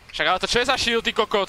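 loud, high indignant yell; furious disbelief at the game; outraged shout. Real, not acted. Shocked by enemy shield mid-fight.

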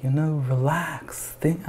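lightly imitated gentle narrator guiding a meditation tape; gentle David Attenborough voice. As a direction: ease the words out like a calming nature documentary. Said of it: "gentle David Attenborough voice".

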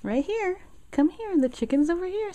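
high, loud coaxing call to a dog. Real, not acted. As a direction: call the puppy over eagerly. Calling the dog over.

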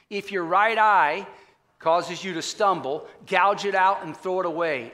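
slow, loud, weighty scripture read; slow solemn Bible reading. Acted. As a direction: read the verse slowly with weight. Reads scripture.

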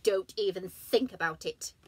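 loud stern warning; stern rabbit. Acted. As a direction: threaten sharply. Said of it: "said the Magic Rabbit".

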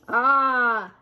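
loud, drawn-out crowd sigh; admiring sigh. Acted. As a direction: sigh aloud in wonder. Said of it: "the crowd sighed".

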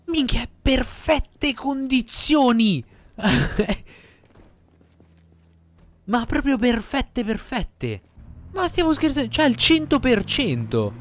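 shouting high with excited disbelief; explosive italian cursing. Real, not acted. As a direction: yell it out, thrilled and amazed. Finds a car in perfect condition. (imprecazioni esplosive ed entusiaste)

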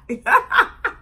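high laugh bursting out. Real, not acted. Vlogger laughing at her old slang. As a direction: cracks up again.

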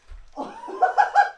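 shrill laughing fit; laughing after the ball hit his face; high burst of laughter. Real, not acted. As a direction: crack up in a sudden high laugh.

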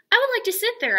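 put-on child asking teacher for the red chair, high and loud; eager little girl. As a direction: ask brightly like a keen five-year-old. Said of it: "I told her".

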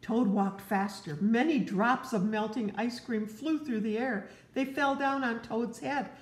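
gentle read-aloud to a little one; storybook narration. Acted. Parent reading Frog and Toad to a child, gently. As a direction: tell it like a bedtime story.

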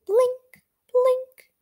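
high twinkly blink; firefly blink. Acted.

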